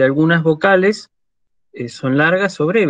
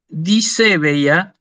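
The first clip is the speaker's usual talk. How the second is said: slow careful reading; much slower than usual. Shown as a demonstration. Teacher presents new word.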